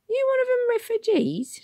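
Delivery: very high, kind Cockney conductress; gentle London bus lady asking; asked kindly. A narrator's reading. Conductress to the boy Tom, high and kind. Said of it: "she asked kindly".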